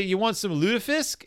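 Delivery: put-on Minnesota accent. Host imitating Minnesotans offering lutefisk in Minnesota accent. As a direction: offer it up like a Minnesota local would. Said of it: "gonna try to do a Minnesota accent".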